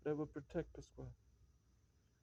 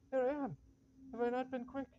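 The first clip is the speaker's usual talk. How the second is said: pitched way up and louder, bursting in; eager arrival; 9 semitones higher, 4 dB louder than usual. Acted. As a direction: come back breathless with pride at being quick. Eager servant rushing back in.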